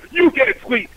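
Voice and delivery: high, mock aggressive retort. Passenger turning on the cab driver, aggressive. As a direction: imitate an indignant passenger going on the attack. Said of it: "Then he gets aggressive with me".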